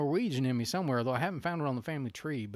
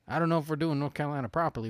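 normal talking voice

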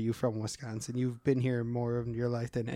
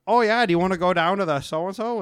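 put-on louder, higher Midwestern accent; Willem Dafoe; 4 semitones higher, 6 dB louder than usual. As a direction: lean into a folksy Wisconsin accent.

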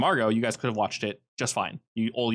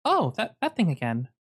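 higher-pitched woman character, taken aback; Marissa; 9 semitones higher than usual. Acted. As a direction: play her high, stepping back from it. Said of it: "takes a single step back and goes".

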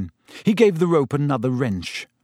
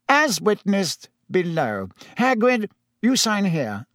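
higher, slow official dictation; reedy committee official; 7 semitones higher than usual. Acted. Committee member has papers signed, reedy and official. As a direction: pitch it up, formal and deliberate.

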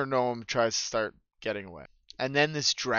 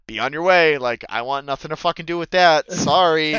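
emphatic dismissal; 4 semitones higher, 6 dB louder than usual. Acted. Character throws prisms away, refusing involvement.

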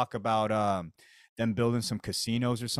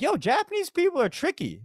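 much higher, amazed outburst; mock amazement; 4 dB louder than usual. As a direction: squeal it, impressed by the scam. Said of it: "I was like".

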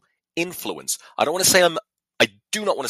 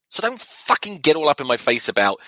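ordinary talk

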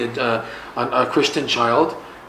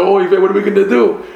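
panicked; 6 semitones higher, 6 dB louder, faster than usual. Acted. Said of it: "the parents are panicking".

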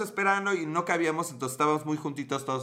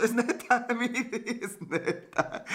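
slow and high through real laughter; giggling it's true; laughing; 8 semitones higher, much slower than usual. Real, not acted. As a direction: insist it's true while cracking up. Laughing insistence.

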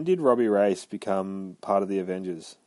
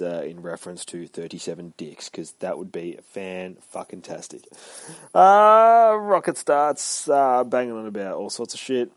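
ordinary delivery

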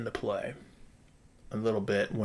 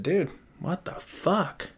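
retold outrage; 9 semitones higher, much slower than usual. Confronting the property manager.

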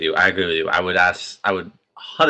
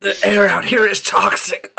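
higher, strained sickly voice; frail breathless old person; 11 semitones higher, 3 dB louder than usual. Pretend. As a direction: play a gasping sick person. Mocking a sick infected person.

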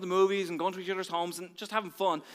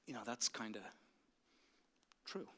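whispered, bashful admission; shy whisper; 8 semitones lower, 15 dB quieter than usual. Retold or imitated. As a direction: barely breathe the confession. Shy confession to Kelly.

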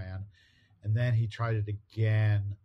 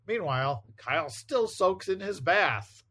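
comic caption narration; 8 semitones higher, 3 dB louder than usual. Acted. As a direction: announce the scene change like a melodramatic narrator. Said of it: "That's what the caption says".

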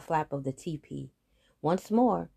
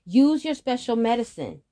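raised, loud urgent call; 6 semitones higher, 8 dB louder than usual. Acted. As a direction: urge him on. Brother urges youngest.

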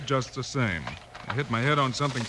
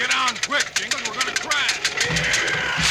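high, urgent cry to jump clear; alarmed high shout of warning; 10 semitones higher, much slower than usual. Acted. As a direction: yell at your partner to get off before the crash. Runaway wagon about to crash.

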